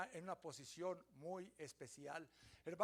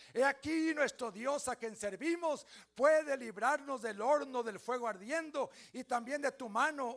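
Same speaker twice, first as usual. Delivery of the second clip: defiant declaration; 4 semitones higher, 9 dB louder than usual. Acted. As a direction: proclaim it to the king's face. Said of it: "dígalo conmigo".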